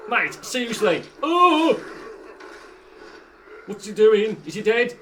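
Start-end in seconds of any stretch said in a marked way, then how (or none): 1.2-1.8 surprised. Real, not acted. Shocked laugh.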